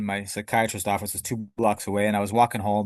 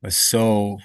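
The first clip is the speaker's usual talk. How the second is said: ordinary delivery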